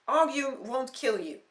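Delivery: slow, loud angry retort; angry shout. Acted. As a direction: shout it back. Shouting back.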